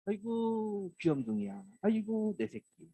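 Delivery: high, doting coo on 아이고; fond cooing. Retold or imitated. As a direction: dote on the sleepy child. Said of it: "하면서 이제 깨우시는 거거든요". (귀여워하며 어르는 목소리)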